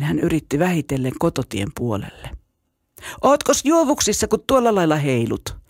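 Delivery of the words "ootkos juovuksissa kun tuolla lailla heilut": high mocking call across the bridge; taunting shout. A narrator's reading. Bully on the bridge shouts a taunt at the other boy, mocking. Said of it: "sillan keinuttaja huusi".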